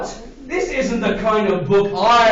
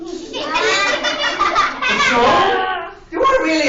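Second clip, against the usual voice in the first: mock alarm; 8 semitones higher, much slower than usual.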